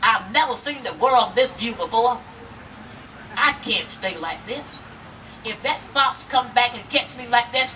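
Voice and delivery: usual speaking voice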